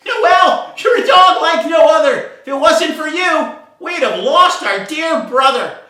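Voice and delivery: very high, loud fish cheering; grateful shout. Acted. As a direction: shout thanks like a hero returned. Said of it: "The fish said".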